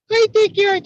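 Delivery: very high, loud, squeakier cartoon mouse; squeaky falsetto dissolving into laughs; Minnie Mouse voice. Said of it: "in the voice of Minnie Mouse".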